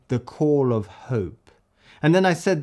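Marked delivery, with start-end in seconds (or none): 0.1-1.5 "The call of hope" drawn out slowly; slow lyric reading. Shown as a demonstration. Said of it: "So I'm gonna say".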